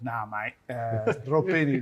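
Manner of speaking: a hint of a New Zealand accent, half laughing; mock Kiwi coach, lightly done. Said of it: "that's my best New Zealand accent".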